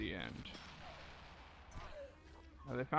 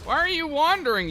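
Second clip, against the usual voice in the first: much higher, loud exasperated shout; exasperated yell; 9 dB louder than usual. Real, not acted. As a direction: yell at the straying unit. Yelling at wandering unit.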